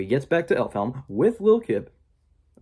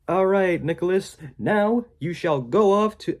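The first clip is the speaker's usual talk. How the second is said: higher, slow pompous pronouncement; grand proclamation; 7 semitones higher, 4 dB louder than usual. Retold or imitated. A character sending Nicholas off on his quest, high and grand. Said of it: "and it's like".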